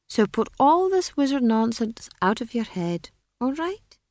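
high, condescending wizard voice; be an amused wizard humouring a child; lofty, high-pitched dismissal; patronizing wizard. A narrator's reading.

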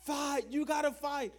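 high-pitched mock shout at normal volume; imitated team yell. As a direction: mimic teammates cheering someone on. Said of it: "team yelling".

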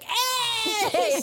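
high cartoon duck cry; hammed-up, high-pitched animal shout; Karu. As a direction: squawk a hello through laughter. Acting out the duck's greeting.

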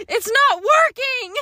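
high, drawn-out mock whiny yell; retold sleep yelling. Wife retelling her sleep-talk, mock distressed yell. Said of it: "you were yelling at me last night".

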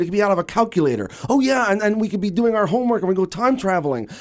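put-on high, eager voice of kids making up a story; kids playing pretend. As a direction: bubble over like a child inventing a game. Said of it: "We were like".

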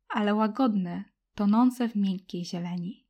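usual speaking voice

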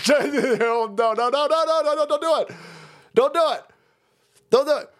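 high repeated no no; mock pleading. Real, not acted. Host begging at screen, high pleading.

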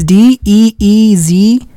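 drawn-out mock-helpful spelling. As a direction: spell it slowly for the scammer, one letter at a time. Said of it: "I make 'em spell it first".

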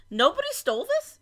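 high-pitched mock disbelief; incredulous. Co-host reacting to packages left outside, incredulous. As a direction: pitch it right up, baffled. Acting disbelief at unguarded mail.

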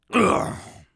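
loud high hacking cough; coughing fit. Acted. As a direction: hack on tobacco dip. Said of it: "I got some dip in my throat".

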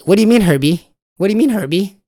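high, loud frantic pleading; mock-terrified high wail; panicked and scared. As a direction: squeal it like a frightened kid begging for answers. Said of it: "You're like".

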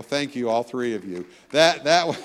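ordinary delivery

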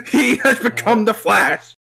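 high, slow epic proclamation; dramatic announcer. Pretend. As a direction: proclaim it like a trailer voice.

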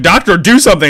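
high, loud pleading mom; mock frantic mom. Retelling the mom begging the doctor, high mock voice. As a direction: beg the doctor in a silly voice. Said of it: "the mom's just like".

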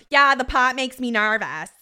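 loud mocking impression of a TV character; big, emphatic mimicked line delivery; Cassidy. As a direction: belt out a hammed-up quote. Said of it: "fucking Cassidy goes".

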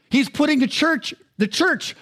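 ordinary talk, nothing marked

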